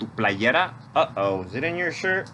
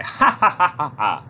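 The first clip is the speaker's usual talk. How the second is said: demo laugh; 2 semitones higher, 5 dB louder than usual. Shown as a demonstration. Storytime leader showing children how to laugh, hearty laugh. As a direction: laugh out loud for the kids. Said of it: "Wiggle your nose and laugh".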